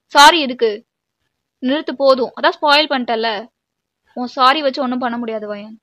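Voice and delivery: loud burst, then easing slightly; angry rejection. Acted. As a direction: cut the apology off loudly. Rejects partner's apology angrily. (கோபமாக மறுப்பது)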